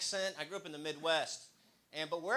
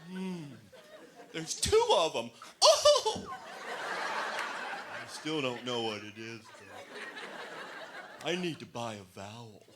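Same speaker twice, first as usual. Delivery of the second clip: dim Wheel of Fortune contestant; 3 dB louder, much slower than usual. Retold or imitated. Comic mocking a Wheel of Fortune player, loud and dopey. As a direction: yell it out like a clueless contestant.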